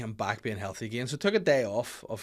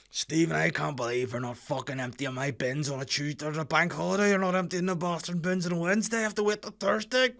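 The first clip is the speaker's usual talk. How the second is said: a hammed-up caller voice climbing higher and louder as the complaint goes on; ranting radio caller; 6 semitones higher, 3 dB louder than usual. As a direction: do an irate local caller ringing the phone-in show, getting more worked up. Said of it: "you phone up Nolan, you're like".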